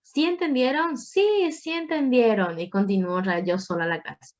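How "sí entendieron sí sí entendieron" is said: high, sing-song question then answering it herself; Dora the Explorer style. Pretend. Teacher mocking silent students, Dora-style self-answer. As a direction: ask the class and answer for them like Dora. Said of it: "si no me siento la Dora, Dora la Exploradora".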